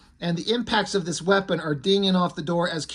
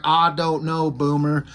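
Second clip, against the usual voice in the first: mock Southern hillbilly accent, a little low; Cannonball's hillbilly voice; 3 semitones lower than usual. Cannonball answering Boomer, in a mock hillbilly accent. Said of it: "hillbilly voice that they give Cannonball".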